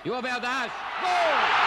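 high rising goal call; goal shout. Real, not acted. Goal scored.

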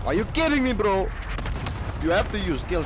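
loud, high outraged shout at the screen; frustrated yell. Real, not acted. Gamer yelling at an enemy player, outraged disbelieving shout. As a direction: let it burst out in disbelief.

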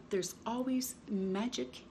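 ordinary delivery

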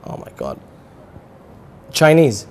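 normal talking voice

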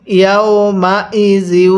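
slow, connected Quran syllables; measured teaching recitation; model recitation. Shown as a demonstration. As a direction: show how it reads, stretched out. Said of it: "কিভাবে পড়ব".

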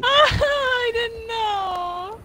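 high, slow laughing cry; laughing protest. Real, not acted. Caught by monster, defending herself.

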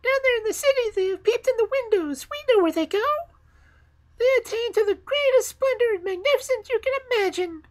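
very high, loud chirpy bird voices; twittering sparrows. Acted. The sparrows boast to the fir tree, very high and chirpy. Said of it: "twittered the sparrows".

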